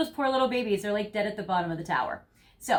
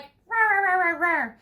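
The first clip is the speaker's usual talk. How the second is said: high playful puppy growls, louder; angry puppy; 9 semitones higher, 5 dB louder than usual. Pretend. You charging at your person, mock angry puppy growl. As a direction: growl like a cranky little puppy. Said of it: "like an angry puppy".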